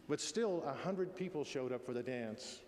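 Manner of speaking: ordinary talk, nothing marked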